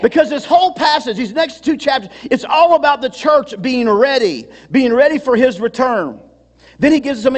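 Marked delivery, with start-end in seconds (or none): none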